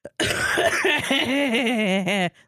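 raspy coughing laugh. Shown as a demonstration. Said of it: "Every single time I laugh, I go like".

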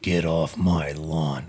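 put-on low, slow grumbling old man; slow grumpy old man voice. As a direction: drag it out like a cranky grandpa. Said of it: "Grumpy old man".

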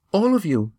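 put-on high, earnest girl's voice; young Eva. A narrator's reading. Eva warning the friends, high worried voice.

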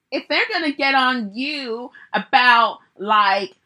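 failed attempt at a rapper's accent, slow and loud; [name]. Retelling the rapper on Instagram live, badly imitated accent. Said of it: "I can't even do her accent".

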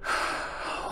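long, soft sigh; relenting sigh. Acted. Mother giving in to her son, sighing. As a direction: breathe out heavily before giving in.

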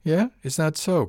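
insolent, goading; sneering. A narrator's reading. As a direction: sneer it like a dare. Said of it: "He sneered at me".

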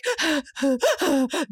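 high sobbing gasps, imitated; imitated baby cry. Mother imitating the baby crying in the car, hitching gasps. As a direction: mimic a baby's hitching sobs. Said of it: "doing the cry".

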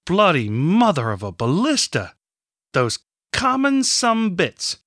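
high angry cursing outburst; ranting. A narrator's reading. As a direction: rant and curse at the enemy. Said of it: "Kenward ranted".

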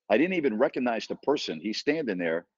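ordinary talk

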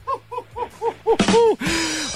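high hooting laugh; long high laughter. Real, not acted. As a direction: crack up at the punchline, pitched high. Host reacts to caller's joke.

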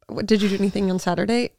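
usual speaking voice

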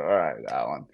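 low, dropping retold reply; resigned annoyance. Client to agent after camp is doubted, annoyed. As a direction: sink the pitch, curbing irritation.

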